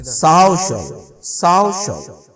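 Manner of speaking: "साओ शव" with "साओ" punched high, "शव" falling away; stress on first syllable. Shown as a demonstration. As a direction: hit "साओ" hard in "साओ शव". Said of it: "तो stress हमारा इस पे आएगा". ("साओ शव" में "साओ" पर तेज़ ज़ोर)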